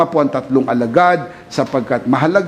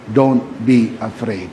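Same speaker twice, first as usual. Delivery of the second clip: soft reassurance; 6 semitones lower, 3 dB quieter, slower than usual. Retold or imitated. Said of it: "Kaya sabi ni Jesus".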